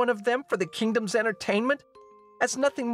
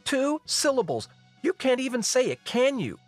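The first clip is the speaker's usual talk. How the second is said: high-pitched, scornful tirade; exasperated princess scolding; angry outburst; 14 semitones higher than usual. Acted. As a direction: berate your father, pitch way up. Princess berating her father.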